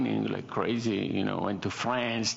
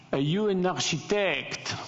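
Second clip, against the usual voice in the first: high-pitched imitation French accent; thick French accent; 9 semitones higher than usual. French gentleman at four in the morning, mock thick French accent. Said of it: "in this really thick French accent".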